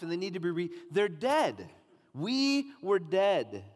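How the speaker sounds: rising, urgent preacher's appeal; preaching at full force. Acted. Preacher urging the congregation to take it personally, impassioned.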